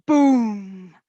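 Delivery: big booming Boom; volcano boom. Acted. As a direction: boom it like a mountain blowing up. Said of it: "roars the mountaintop".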